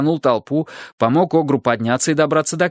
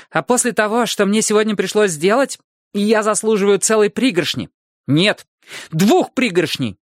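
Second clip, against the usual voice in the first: put-on high, insistent kid voice; demanding child angel; 8 semitones higher than usual. A narrator's reading. Angel boy demands two handfuls of chocolate, high and insistent. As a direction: push it up into a greedy child's pitch.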